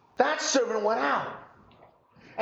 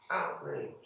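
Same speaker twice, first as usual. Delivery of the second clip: whispered echo, much softer; 9 dB quieter than usual. Acted. Preacher echoes the servants' anger to the congregation in a whisper. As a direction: breathe the word outraged as a whisper. Repeats outraged.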